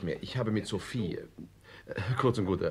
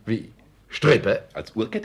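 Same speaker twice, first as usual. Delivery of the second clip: much louder, higher, slowed disbelief; incredulous; 4 semitones higher, 10 dB louder than usual. Acted. Guest reacting in disbelief to the watch-chain story. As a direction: repeat it in loud disbelief.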